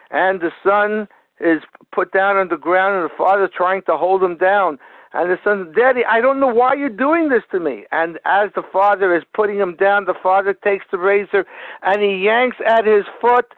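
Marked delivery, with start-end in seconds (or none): 5.7-7.9 put-on higher-pitched, bewildered boy's protest; imitated child's plea. Son held down by his father, imitated bewildered plea. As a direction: plead like a child, lightly acted.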